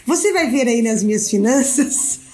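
talking through giggles; giggling between words; stifled giggles. Real, not acted. Laughs through her own joke.

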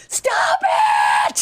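high, drawn-out cry at the end of your tether; retold exasperated yell. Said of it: "I didn't yell at her".

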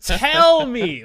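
loud, emphatic gossip-hungry plea; eager begging. Pretend. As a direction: beg loudly for the juicy details. Acted eager gossip with operator.

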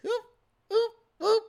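short, high-pitched teasing oops; little high oop noises to troll them; mocking oops. Host mimics the president trolling his accusers, mocking oops. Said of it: "he's like this".

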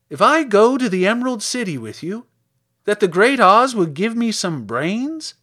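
high, loud scarecrow voice; hopeful scarecrow. Acted. Scarecrow asks girl about getting brains, hopeful.

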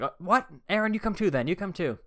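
high mock teacher, surprised then firm; hammed-up teacher ordering him along. Teacher telling Aaron to come too, high mock voice. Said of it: "She's like".

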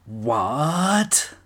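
a hint of an amazed kid; mock surprise. Fan at home seeing them in the ring together, surprised. Shock at first ring meeting.

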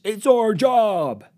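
high, loud, drawn-out mock protest; mocking stretched-out cry; whining journalist. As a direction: wail it like an indignant reporter. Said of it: "No one hates being called out more than journalists".

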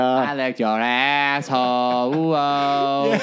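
slow crooning joke lyric; lightly sung parody ballad; mock love song. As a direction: croon it slow like a cheesy RnB singer.

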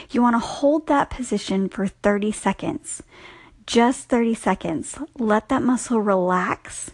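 normal talking voice

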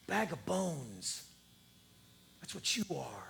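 hushed whisper. Acted. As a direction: drop to a whisper on it. Jesus condemning leaders, hushed.

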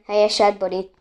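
plain reading voice

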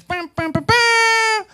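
high trumpet fanfare sung out as "bam"; imitated trumpet blast. Israelites blowing their trumpets around Jericho, mock fanfare. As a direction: blare it like a horn, last note loudest. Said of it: "blowing their trumpets".

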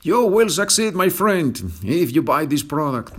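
high, loud, over-the-top ad announcer; American commercial voice. Pretend. Teacher mocks an American commercial, hammed-up and loud. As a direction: sell it like a cheesy TV pitchman. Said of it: "An American commercial".

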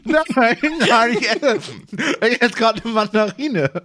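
high, loud protesting no; loud defensive denial. Real, not acted. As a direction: deny it loudly, caught out. Caught eating, loud denial.

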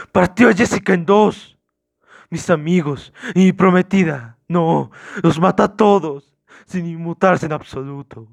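high, drawn-out distraught shouting. Acted. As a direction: shout the horror out, stretching the words. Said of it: "gritando dijo".

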